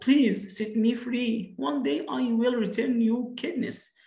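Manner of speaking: high, slow plea of a tiny mouse; crying out, pleading. Acted. Tiny mouse begging the lion for freedom, high pleading cry. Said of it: "cried out".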